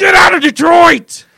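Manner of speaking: screamed very high and loud; furious shout. Acted. Fans running the player out of town, screaming. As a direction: throw him out with a huge yell. Acted eviction from Detroit.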